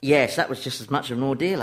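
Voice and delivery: ordinary delivery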